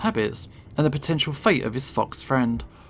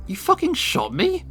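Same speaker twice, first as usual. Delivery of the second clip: high, cracking cry of disbelief; stunned that he's bleeding; shocked disbelief. Acted. Shot bat gasping at the gunwoman, high shocked disbelief.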